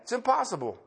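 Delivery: normal talking voice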